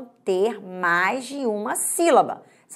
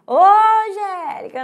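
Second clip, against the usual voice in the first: mock gate call, high-pitched "Ô, Angélica"; wrong way of calling; 9 semitones higher, 6 dB louder than usual. Shown as a demonstration. Teacher jokes how not to call at the gate, mock calling out. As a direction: playfully holler it the wrong way, higher. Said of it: "Eu não vou falar assim".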